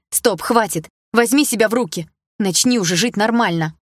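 loud, sharp commanding yell. Acted. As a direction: snap her out of it. Narrator yells at sister.

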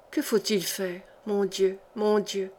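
soft, helpless lament; subdued despair. A narrator's reading. Talk of a woman too ill to travel, quietly despairing. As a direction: let the voice drop in quiet helplessness. Despairing over the dying patient.

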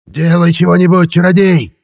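high, loud frantic yell; old man's shout. A narrator's reading. Old grandpa yells at the sorcerer, high and loud. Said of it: "крик деда Силантия".

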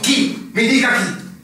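high, loud, insistent; urgent demand. Acted. Lieutenant pressing the captain to name who stayed, urgent demand.

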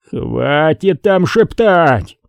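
высокий протяжный окрик вполсилы; изобрази крик, не прибавляя громкости. A narrator's reading. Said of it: "заорал Дантек". (условный крик, слова сильно растянуты)

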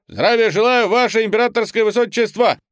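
loud, high barked reply; military shout. Acted. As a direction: shout it at attention. Cadet answers the duke.

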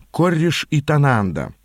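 ordinary delivery

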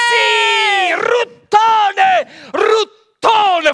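high, loud repeated yell; mock-brave summoning cry; calling out. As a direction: shout the name to the spirits. Calling the devil by name.

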